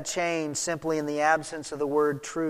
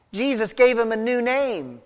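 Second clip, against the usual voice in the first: usual speaking voice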